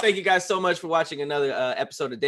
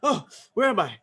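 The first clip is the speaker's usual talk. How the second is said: higher, dazed little voice; dazed dog voice; 5 semitones higher than usual. Pretend. The dog after passing out, higher and dazed. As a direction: play the dog waking up confused.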